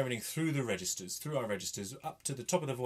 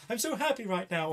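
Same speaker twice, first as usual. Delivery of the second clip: bright, higher happy line; 10 semitones higher, 4 dB louder than usual. Shown as a demonstration. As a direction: sound thrilled, pitch up. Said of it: "Give it a".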